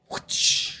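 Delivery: whooshing whip-crack imitation; whip-crack noise. Streamer pretending to grab the whip, imitated whip crack. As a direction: make an unvoiced whip whoosh with the mouth.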